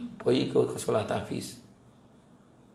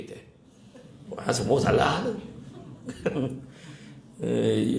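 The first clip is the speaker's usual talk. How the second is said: ordinary talk, nothing marked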